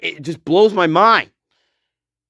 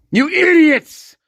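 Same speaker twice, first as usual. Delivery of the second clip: real exasperated yell; angry shout; 8 semitones higher than usual. Real, not acted. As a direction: scream it at them. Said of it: "I'm sorry for shouting".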